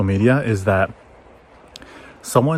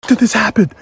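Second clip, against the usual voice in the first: high, shouted imitation of an angry boss; mock furious shout; 11 semitones higher than usual. Partner yelling at the junior trader, high mock shout. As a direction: yell it at the trader in mock rage. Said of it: "everyone's screaming".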